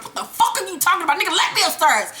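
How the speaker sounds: high, rushed mock outburst; flipping his lid. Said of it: "Ghost flips his motherfucking lid".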